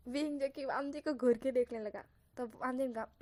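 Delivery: ordinary delivery, nothing marked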